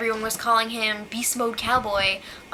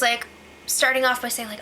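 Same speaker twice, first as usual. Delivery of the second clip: usual speaking voice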